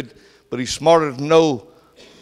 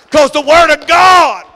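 shout; 11 semitones higher, 8 dB louder than usual. Real, not acted. Preacher's shouted climax.

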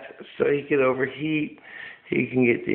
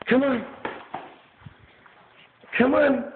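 coaxing high-pitched come on to a dog; sing-song pet call; 11 semitones higher than usual. Real, not acted. Calling the drunk dog over.

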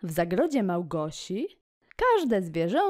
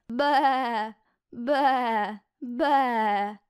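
lightly raised bleat; sheep bleating; 5 semitones higher, much slower than usual. Acted. Reading the poem to kids, a light sheep bleat. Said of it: "Owca beczy".

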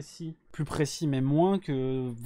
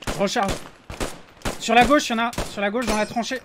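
loud callout; 8 semitones higher, 8 dB louder than usual. Real, not acted. Calling out reload and enemies.

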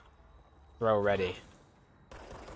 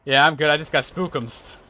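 scared horror game streamer; 3 semitones higher, 7 dB louder than usual. Real, not acted. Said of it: "not expecting that lad to come out and jump scare me".

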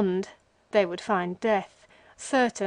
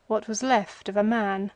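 ordinary delivery

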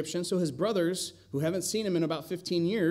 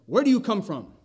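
loud, higher, rough demand; 6 semitones higher, 7 dB louder than usual. Acted. Joseph interrogating his brothers, loud and rough. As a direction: bark it at the strangers like a suspicious governor. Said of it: "he spoke roughly to them".

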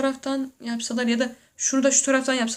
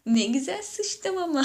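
higher, mock-pleased; smug toilet neighbour; 6 semitones higher, 3 dB quieter than usual. A neighbour on the toilet, mock-satisfied and higher. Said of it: "Birbirinize böyle bakıp".

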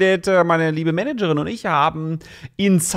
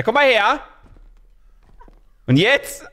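usual speaking voice

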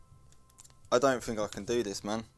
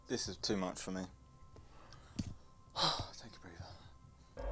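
sighing, low and very soft; overwhelmed, hushed; 5 semitones lower, 14 dB quieter, slower than usual. Real, not acted. Player steadying himself in a horror game, hushed and drained. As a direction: let it sink to a breathy near-whisper.

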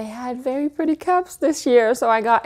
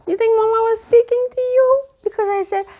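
pet voice; 12 semitones higher, 4 dB louder than usual. Real, not acted. Owner talking to her dog Lennox in high baby talk. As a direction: coo at your pup like Mama. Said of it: "that's how I, I speak to him".

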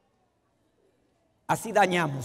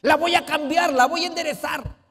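mock domineering husband; 7 semitones higher than usual. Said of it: "esposos que creen".

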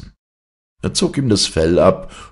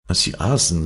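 plain reading voice

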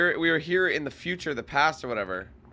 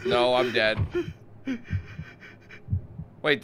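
ordinary talk, nothing marked

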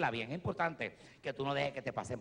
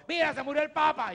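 loud, much higher shout from the crowd; heckler yelling; 13 semitones higher, 7 dB louder than usual. Retold or imitated. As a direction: yell it from the back of the room. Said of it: "un tipo me grita".

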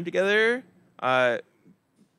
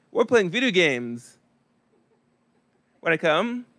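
1 eager misheard invitation; 2 semitones higher than usual. Retold or imitated. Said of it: "But what I heard was".

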